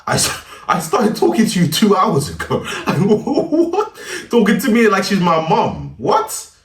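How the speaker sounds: high, loud disbelief with a laugh; incredulous outburst. Retold or imitated. Telling viewers she talked like his mum, incredulous. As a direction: squawk it in mock outrage, pitch way up. Incredulous retort while retelling her messages.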